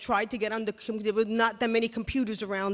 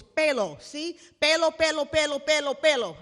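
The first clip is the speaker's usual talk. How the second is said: mock boss pointing; 4 semitones higher, 4 dB louder than usual.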